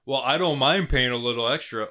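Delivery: loud mocking put-on voice; mocking commenter. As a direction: sneer it as a smug commenter.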